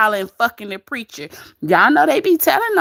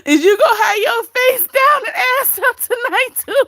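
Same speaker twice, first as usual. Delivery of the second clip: laughing; 12 semitones higher, 3 dB louder than usual. Real, not acted. Teasing viewer, laughing hard.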